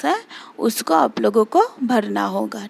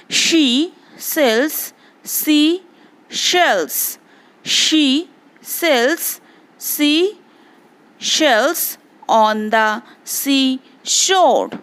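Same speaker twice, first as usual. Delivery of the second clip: tongue twister spoken slowly and evenly; slow demo; 2 semitones higher, much slower than usual. Shown as a demonstration. Teacher demonstrates the tongue twister slowly for the class. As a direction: space the words out so pupils hear every sound. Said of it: "Again I repeat".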